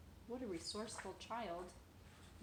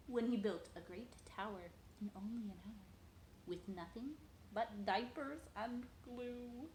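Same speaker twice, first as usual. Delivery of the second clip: ordinary delivery, nothing marked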